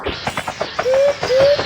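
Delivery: dude called out high, rising; high urgent calling. Pretend. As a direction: squeak it out to hurry them. Urging a friend in game.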